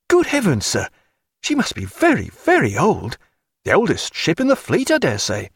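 high-pitched youthful astonishment; amazed young midshipman. A narrator's reading. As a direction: exclaim it high as an awestruck lad.

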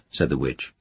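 plain reading voice

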